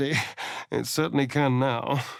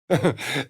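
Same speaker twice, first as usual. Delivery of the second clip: short laugh. Acted. Tag alone, laugh.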